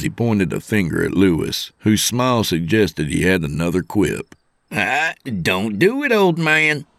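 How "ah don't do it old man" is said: slow, high put-on youngster voice; warning young trainee. Trainee heading off the old man's quip, warning. Kyle warns Lewis off another quip.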